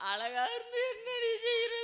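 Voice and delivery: high-pitched stagey wail; mock crying. Woman laments to her friend about beauty, wailing. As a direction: bawl the complaint out, very high. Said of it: "அழுகாதடி".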